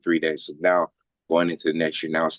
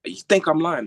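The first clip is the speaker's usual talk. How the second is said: high-pitched mock indignation; raised, accusing retold question; pissed-off doctor; 10 semitones higher, 3 dB louder than usual. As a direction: imitate an angry doctor's challenge. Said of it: "She was pissed".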